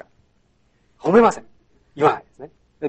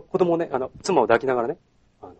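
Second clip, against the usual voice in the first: usual speaking voice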